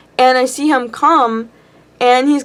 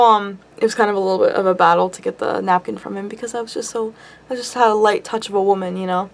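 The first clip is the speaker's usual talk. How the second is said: normal talking voice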